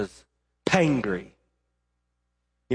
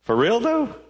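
higher, mock-disbelieving; incredulous; 9 semitones higher than usual. God questioning Jonah, higher incredulous tone. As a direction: say it like you can't believe it. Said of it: "It, it could have been".

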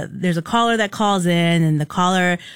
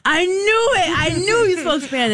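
high, exclaiming vindication; imitating someone proven right, pitch leaping up; retold triumphant outburst; 10 semitones higher than usual. Contractor finds out she spoke Spanish, triumphant.

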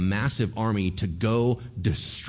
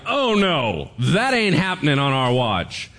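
loud, raised defiant refusal; defiant nation; 5 semitones higher, 6 dB louder than usual. Acted. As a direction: refuse it loudly. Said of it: "The nation was like".

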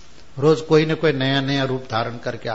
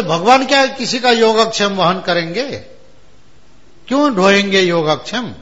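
louder, higher doubting question; skeptical scholar; 5 semitones higher, 5 dB louder than usual. Retold or imitated. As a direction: scoff at the idea. Scholar doubts God carries. (संदेह भरा विद्वान, ऊंचा प्रश्न)

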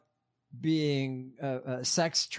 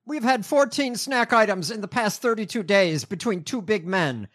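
high, loud, aggrieved complaint; mock-indignant note reading; 8 semitones higher, 7 dB louder than usual. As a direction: declaim the petty grievance in a raised voice. Reads aloud jailed man's whiny note.

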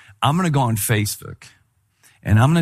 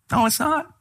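incredulous; 13 semitones higher than usual. Retold or imitated. Denying filtered photo.

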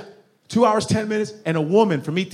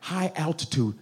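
plain quiet; 8 dB quieter than usual. Shown as a demonstration. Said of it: "That's right".

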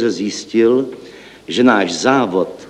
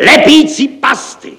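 much higher, louder, shrieking despair; hysterical cry; 7 dB louder, slower than usual. A narrator's reading. Director losing nerve before six, crying hysterically. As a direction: lose your nerve and shriek it. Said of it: "volal hystericky".

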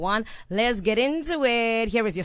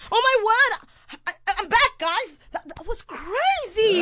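breathless excited return; 11 semitones higher than usual. Acted. Presenter returns flustered.

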